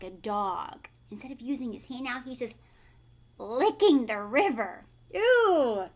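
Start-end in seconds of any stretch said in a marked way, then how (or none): 5.1-5.9 high, loud disgusted ew; grossed out. Real, not acted. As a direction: recoil at the gross sight. Teacher reacts to licking.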